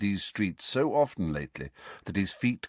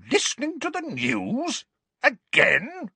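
much higher, shocked repeat; outraged Aunt Petunia; 3 dB louder than usual. A narrator's reading. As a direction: shriek the echo in outrage.

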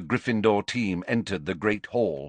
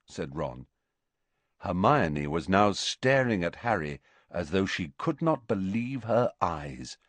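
usual speaking voice

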